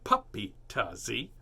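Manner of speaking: slow, firm telling-off of the dog; a light impression of a teacher scolding; imitating Miss Christie. Miss Christie scolds the puppy Tazzy, slow and firm. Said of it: "like when Miss Christie goes".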